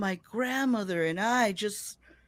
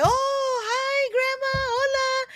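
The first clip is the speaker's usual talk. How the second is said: put-on nervous young self; 4 dB louder, slower than usual. Greeting grandma nervously on phone.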